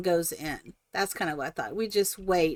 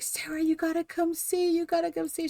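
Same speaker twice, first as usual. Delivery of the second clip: higher, urgent beckoning; eager calling; 8 semitones higher, 4 dB quieter than usual. Retold or imitated. Mother calling her daughter Sarah over, higher and eager. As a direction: hurry your daughter over. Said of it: "I'm like".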